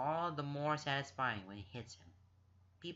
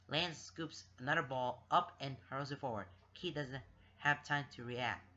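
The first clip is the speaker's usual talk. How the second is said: ordinary delivery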